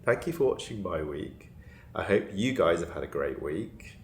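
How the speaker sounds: ordinary delivery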